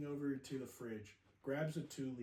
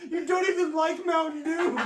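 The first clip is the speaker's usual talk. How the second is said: mock scolding mom; 12 semitones higher, 15 dB louder than usual. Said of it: "she's like".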